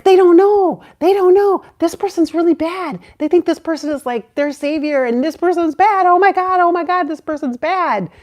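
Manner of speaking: high, loud, urgent warning; reliving panic, insist loudly that they don't know; worked-up alarmed pleading; frantic alarm. Retold or imitated. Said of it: "I was just, like, so in that space".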